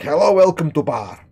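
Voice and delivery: loud mock Eastern European accent; Vadim impression. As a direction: greet like a gruff foreign barkeep. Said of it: "Vadim owns the bar. He's like".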